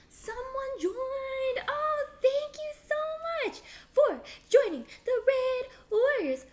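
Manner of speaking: high, loud singsong thanks; thrilled welcome. Real, not acted. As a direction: gush in long, drawn-out high notes. Streamer greets someone who joined.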